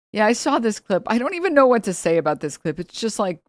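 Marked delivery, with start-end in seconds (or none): none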